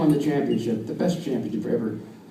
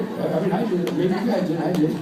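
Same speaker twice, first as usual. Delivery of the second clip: usual speaking voice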